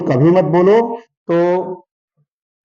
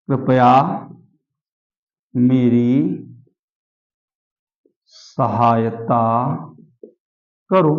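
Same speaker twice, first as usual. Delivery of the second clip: lower, drawn-out words with long gaps; word-by-word dictation; 5 semitones lower, much slower than usual. Shown as a demonstration. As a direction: dictate slowly, waiting after every word. (धीरे-धीरे, एक-एक शब्द लिखवाते हुए)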